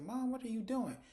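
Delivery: high-pitched daughterly protest; retold exasperation. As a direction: act out a daughter embarrassed by her mom. Daughter embarrassed by mother's joke.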